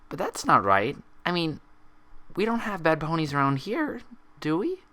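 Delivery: high, uneasy pony voice; worried Pinkie. Acted. Pinkie asking Applejack about bad ponies, high and uneasy. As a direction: doubt creeping in.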